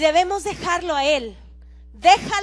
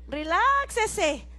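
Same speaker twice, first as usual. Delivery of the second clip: stretched out soothing reláxese; slow calm; 3 semitones higher, much slower than usual. Shown as a demonstration. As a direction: draw out reláxese very slowly. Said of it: "drawn-out calming command". (reláxese lento y calmado)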